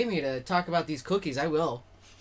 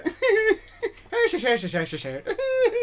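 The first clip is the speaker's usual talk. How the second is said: high, loud babble in fake Irish accent; fake Irish gibberish; 6 dB louder than usual. Shown as a demonstration. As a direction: rattle off singsong Irish-sounding nonsense, laughing. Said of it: "as my fake Irish accent".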